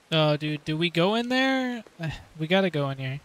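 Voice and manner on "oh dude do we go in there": usual speaking voice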